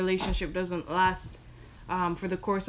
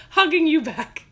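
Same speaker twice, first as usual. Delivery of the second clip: laughing; 8 semitones higher, 11 dB louder than usual. Real, not acted. Laughs at own creepy hug.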